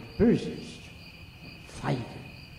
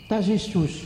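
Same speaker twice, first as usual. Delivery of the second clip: triumphant; 6 semitones higher, 4 dB louder than usual. Acted.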